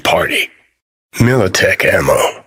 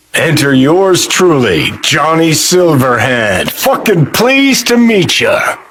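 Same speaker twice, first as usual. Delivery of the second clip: high, swooping flourish on the name and greeting; showman's self-introduction; 6 semitones higher than usual. Acted. Game character introducing himself to companion, showman's flourish. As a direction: announce yourself with mock grandeur.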